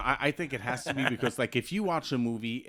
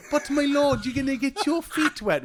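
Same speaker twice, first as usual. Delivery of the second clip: high, loud mock British accent; a disciple fussing in a hammed-up high British accent; 13 semitones higher, 7 dB louder than usual. The disciple warning Jesus in a high, loud, mock British voice. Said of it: "that's how they talk".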